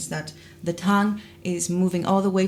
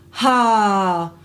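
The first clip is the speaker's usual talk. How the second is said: pressed onset; 2 semitones higher, 5 dB louder than usual. Shown as a demonstration. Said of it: "this is too much for the vocal cords".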